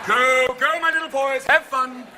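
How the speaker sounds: high, loud cheering-on; hammed-up doting encouragement; indulgent send-off. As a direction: wave the kids off to play. Acted officials waving snowboarders on.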